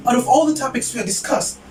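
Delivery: ordinary delivery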